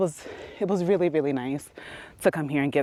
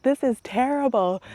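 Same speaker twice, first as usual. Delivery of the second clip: put-on whiny teen; 7 semitones higher than usual. Teen complaint again.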